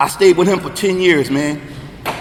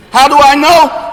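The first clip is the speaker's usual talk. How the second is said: louder and higher, slowed, preaching; forceful question; 6 semitones higher, 6 dB louder, slower than usual. Real, not acted. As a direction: punch the question to the room. Testimony peak, dramatic question.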